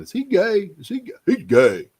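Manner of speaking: hammed-up high-pitched gossip voice; mock gossip. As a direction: ape the nosy askers in a high squeal. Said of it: "people ... come to me saying".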